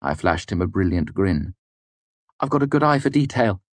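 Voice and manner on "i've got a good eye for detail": smug and pleased with himself. Acted. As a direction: show off your keen eye, smugly. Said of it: "I said smugly".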